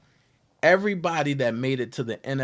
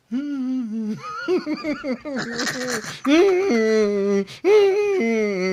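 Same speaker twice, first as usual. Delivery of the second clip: cracking up at his former self; hearty laugh; 9 semitones higher than usual. Real, not acted. Laughing at his own change.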